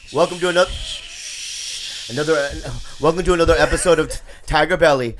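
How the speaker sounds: high, loud announcer-style welcome; loud show intro. Real, not acted. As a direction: belt out the podcast opening. Shouted podcast intro.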